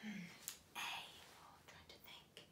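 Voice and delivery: hushed, whispery pondering; thinking aloud. Pretend. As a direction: murmur it to yourself while pretending to think.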